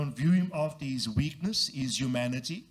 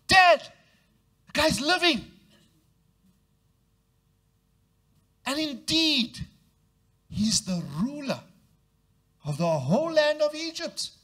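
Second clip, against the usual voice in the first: high, loud, eager telling; excited sons; 9 semitones higher, 5 dB louder than usual. Acted. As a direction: blurt it out to Dad. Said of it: "I would say like that".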